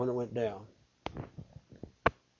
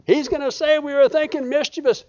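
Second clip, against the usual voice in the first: much higher quoting voice; retold joke; 9 semitones higher than usual. Preacher quoting a friend's joke about dropping dead. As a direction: toss off the friend's quip in a higher voice. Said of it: "somebody said".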